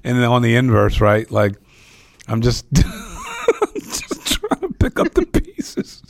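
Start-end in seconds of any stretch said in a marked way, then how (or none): 2.7-6.0 slow, squeaky words broken by laughter; struggling to speak through giggles; laughing. Real, not acted. Host laughing at his tangled question, slow and giggling.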